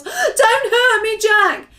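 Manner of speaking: high, slow wailing plea; yelled. A narrator's reading. Giant begging Jack not to hurt him, yelled. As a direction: yell it out begging. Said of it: "he yelled".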